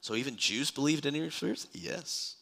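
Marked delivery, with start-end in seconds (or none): none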